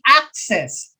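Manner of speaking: AC stressed in "access", falling on the second syllable; first-syllable stress. Shown as a demonstration. Teacher sounding out option B, "access" stressed on first syllable. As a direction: say "access" as AC-cess. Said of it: "first syllable".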